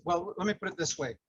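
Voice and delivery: normal talking voice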